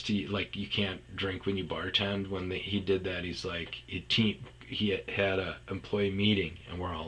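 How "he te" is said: ordinary delivery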